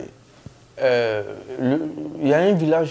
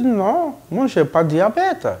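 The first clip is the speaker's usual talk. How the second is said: protesting; 11 semitones higher than usual. Retold or imitated. He protests to nurse.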